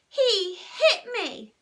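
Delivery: high, loud, drawn-out whine; tearful little tell-tale; wailing accusation. A narrator's reading. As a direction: wail it to Mum. Wronged child wailing to Mum.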